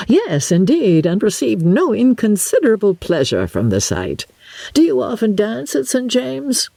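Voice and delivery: an eager, over-polite host asking questions; Sir William. Acted.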